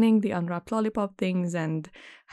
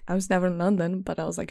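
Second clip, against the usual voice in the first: usual speaking voice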